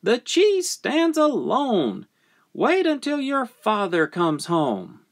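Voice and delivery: high, emphatic mother; high motherly warning; mother scolding. Acted. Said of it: "the mother said".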